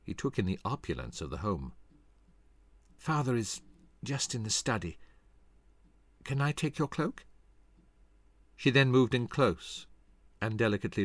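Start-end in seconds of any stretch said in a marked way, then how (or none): 3.0-7.3 slightly deflated lady. Acted. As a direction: offer hospitality with a touch of disappointment. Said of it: "slightly deflated".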